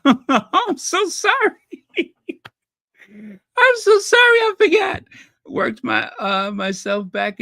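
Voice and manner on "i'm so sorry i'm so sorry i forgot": high-pitched apology squealed through laughter; embarrassed laughing. Real, not acted. Host apologizing to a viewer she forgot, embarrassed laughing. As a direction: apologize while cracking up, pitch way up. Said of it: "That's why I'm laughing".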